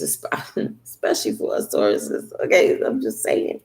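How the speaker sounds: low breathy giggle; giggling aside. Real, not acted. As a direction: laugh the words out under breath. Giggly aside about Tauruses.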